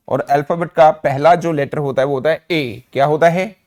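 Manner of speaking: usual speaking voice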